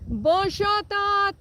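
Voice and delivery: high, loud sing-song call; calling the cows. Real, not acted. Farmer calling her cow Dot in French. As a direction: call Dot from across the paddock. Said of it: "let's call 'em".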